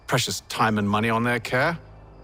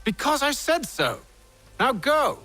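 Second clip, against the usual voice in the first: high, forceful command; angry order; 12 semitones higher, slower than usual. Acted. Man overruling his partner who asks why, angry order. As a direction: pull rank, pitch jumping up.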